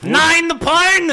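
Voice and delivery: put-on very high, loud, slow German accent; mock German accent. Forbidding porn in Italy, shouted mock German accent. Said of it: "that was some kind of German".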